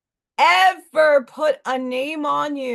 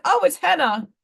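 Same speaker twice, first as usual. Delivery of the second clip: higher, giggling excuse; laughing fib; 7 semitones higher than usual. Retold or imitated. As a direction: laugh it off with a guilty lie. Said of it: "I'm like".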